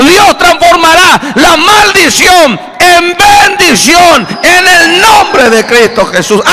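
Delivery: climbing from firm to a high, loud shout; preaching at full force. Acted. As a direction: build the promise until you're shouting it at the church.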